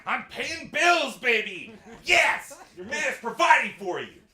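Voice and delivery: high, loud, drawn-out triumphant shout; proud boast. Retold or imitated. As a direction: crow it like a proud provider. Proud husband boasting to wife.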